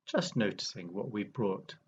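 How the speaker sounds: unhurried meditation guide, long pauses between phrases; guided meditation. Real, not acted. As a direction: ease listeners into awareness, phrase by phrase, leaving space.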